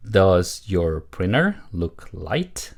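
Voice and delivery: slow, word-by-word model question; model reading. Shown as a demonstration. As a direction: read it slowly for learners to repeat. Teacher models sentence for repetition. (천천히 또박또박 읽는 시범)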